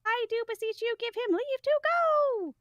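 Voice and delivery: high-pitched doddering elder; doddering old man. Acted. Polonius begging the king to let his son go, high doddering old man. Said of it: "like, a doddering old man".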